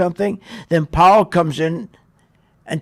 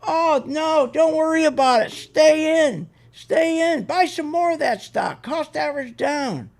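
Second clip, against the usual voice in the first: high, sugary mock reassurance, much higher; mocking soothing advisor; 9 semitones higher than usual. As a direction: ham up a smug broker calming a nervous client. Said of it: "No, what do they say?".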